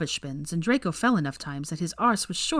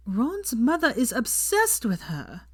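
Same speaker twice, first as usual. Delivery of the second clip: grave; 6 semitones higher, slower than usual. A narrator's reading. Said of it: "she said gravely".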